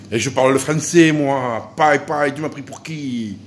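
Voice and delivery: indignant child in hammed-up southern French accent; kid imitating his French accent. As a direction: retort loudly in a regional French accent, stretching the ending. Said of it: "parler avec mon accent". (réplique indignée avec accent français du Midi exagéré)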